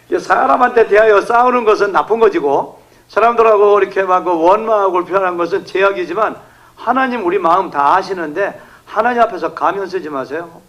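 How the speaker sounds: ordinary talk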